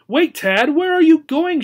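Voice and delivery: alarmed call after him; exclaiming. Acted. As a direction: gerbil cries out as the boy passes again. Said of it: "she exclaimed".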